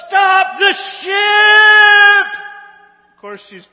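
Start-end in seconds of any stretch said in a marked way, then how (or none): 0.1-2.4 long, high, drawn-out yell; much louder and higher, desperate call; full shout. Retold or imitated. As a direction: holler it as if across the water, stretching each word. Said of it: "she shouts".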